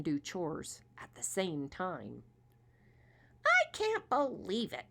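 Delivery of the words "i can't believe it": squirrel voice. Acted. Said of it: "said the little squirrel".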